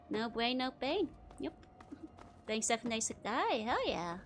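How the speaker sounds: usual speaking voice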